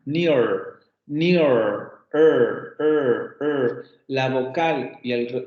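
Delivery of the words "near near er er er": "near" with a drawn-out r-coloured ending, then "er" isolated; rhotic vowel. Shown as a demonstration. Teacher demonstrating the rhotic vowel to students, "near" with strong final R. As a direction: hold the American R in "near" and "er". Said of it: "al final una vocal rótica".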